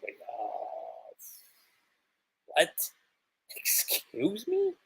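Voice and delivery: breathy, then a high, slow "Excuse me?"; stunned and lost for words; confused surprise. Acted. Character thrown off by news.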